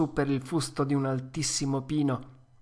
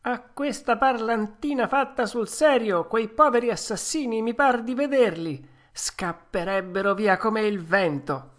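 high, boastful puppet voice; 6 semitones higher, 4 dB louder than usual. Acted. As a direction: brag like a cocky wooden boy. Narrator voices Pinocchio boasting.

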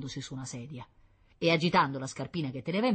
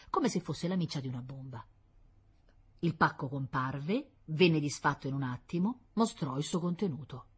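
ordinary delivery

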